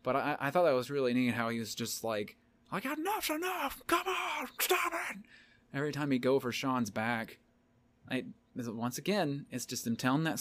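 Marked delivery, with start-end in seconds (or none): 2.7-5.2 squeaky high ref pleading; high pinched plea to stop; high-pitched ref voice. Retold or imitated. Ref voiced in wrestling recap.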